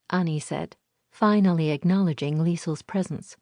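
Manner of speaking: ordinary delivery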